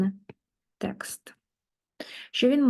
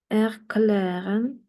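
slow model word; much slower than usual. Shown as a demonstration. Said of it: "teacher models slowly".

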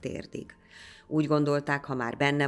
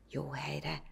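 quiet hushed answer; soft reply; 8 dB quieter than usual. A narrator's reading. Boy answering the priest quietly with a wink, hushed. Boy whispers near soldiers.

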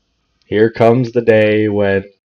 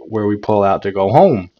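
normal talking voice

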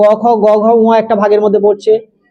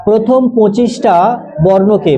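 slow dictation; slower than usual. Acted. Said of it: "একটু শুনে নে ভালো করে".

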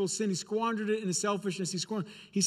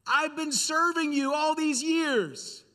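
put-on aggrieved older son; 7 semitones higher, slower than usual. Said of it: "notice his statements".

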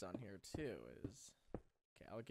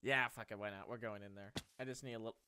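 ordinary talk

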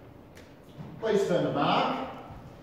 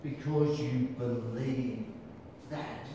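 believe leaned on slowly, low and soft; believe drawn out, softer and lower; stress on believe; 9 semitones lower, 9 dB quieter, slower than usual. Shown as a demonstration. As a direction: press the word believe. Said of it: "I'm stressing that word".

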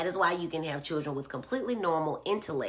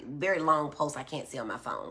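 ordinary talk

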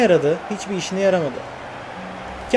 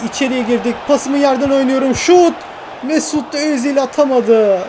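building higher to a shouted peak; excited play-by-play; 5 semitones higher, 3 dB louder than usual. Real, not acted. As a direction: commentate the attack up to a cry. Live attack building to a shot.

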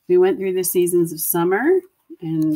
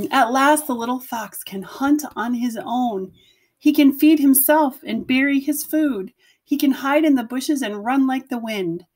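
bright, higher storytelling voice building up; lively storybook narration; 6 semitones higher than usual. A narrator's reading. Teacher reading the climax of the fox story to her class, lively narration. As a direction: read it with rising excitement. Reads picture book aloud.

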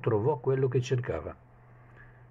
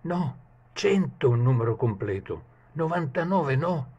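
a much higher, strained voice for the servant; fretting page; 6 semitones higher than usual. Acted. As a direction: worry aloud like a greedy servant. Page's thoughts continued.

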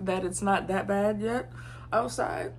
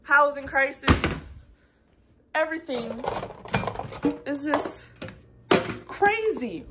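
exasperated; 6 semitones higher than usual. Real, not acted. Venting, voice climbing high.

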